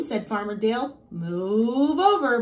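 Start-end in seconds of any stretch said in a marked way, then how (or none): 1.1-2.4 a slow, loud bossy cow; a lightly voiced cow giving orders. A narrator's reading. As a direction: shove the others over, cow style. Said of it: "bossed the cow".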